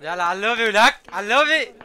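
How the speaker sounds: loud, high rallying shout; loud callout. Real, not acted. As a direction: yell at the team to push. Rushing team to lobby.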